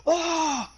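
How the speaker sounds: very high yelp; short, high hammed-up scream of pain; mock pain scream. Acts axe hit to back.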